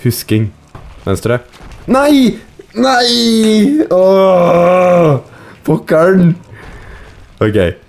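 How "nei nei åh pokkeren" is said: high, drawn-out wail of frustration; anguished gamer outburst. Real, not acted. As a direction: cry out as the game goes wrong. Loses in the game.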